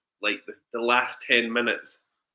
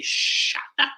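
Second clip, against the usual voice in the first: hissed under the breath; unvoiced hiss of frustration; whispered shut up. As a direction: mouth it through gritted teeth. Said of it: "grab them and say".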